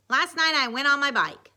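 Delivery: lightly acted boy explaining; Dwight. A narrator's reading. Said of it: "he said using".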